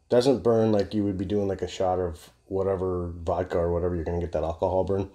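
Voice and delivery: ordinary talk, nothing marked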